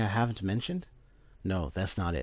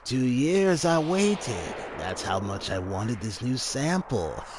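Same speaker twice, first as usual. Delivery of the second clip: much higher, slow and louder gloating; creepy mad scientist; 5 semitones higher, 5 dB louder, slower than usual. Acted. Hojo gloating to Gast about the sample, slow, high and creepy. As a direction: savour every word like a greedy villain.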